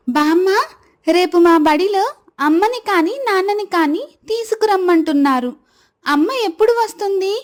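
put-on high child's voice; little girl Veda, asking. Child asking grandmother about her mother, high and pleading. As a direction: ask eagerly as a small girl.

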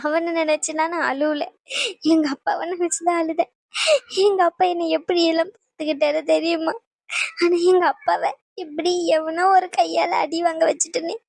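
halting, sob-choked confession; tearful. Acted. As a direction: grieve for your father through tears. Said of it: "எதுக்கு நீ இப்படி அழுதுகிட்டு இருக்க".

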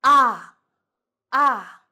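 "Ah," long, loud, falling; drawn-out realization; understanding ah. Shown as a demonstration. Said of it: "should be very long".

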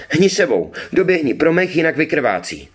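urgent, high-pitched call. A narrator's reading. Girl sends her brother for moss to save the mare, calling urgently. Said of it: "Zavolala na Birka".

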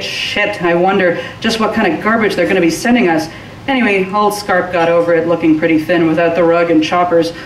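plain reading voice

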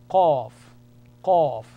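Arabic letter sound demo; deep rounded qaf. Shown as a demonstration. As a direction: hit Qaf hard and rounded from deep in the throat. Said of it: "A rounded sound".

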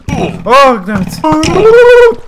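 high, very loud yell of fright; scared outburst. Real, not acted. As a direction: curse out loud in a sudden scare. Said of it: "all the right to be scared".

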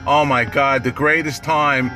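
ordinary talk, nothing marked

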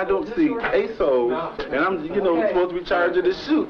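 usual speaking voice

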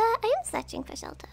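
mock-secretive whisper to chat. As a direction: whisper it back at chat, playfully. Retorting to chat's question.

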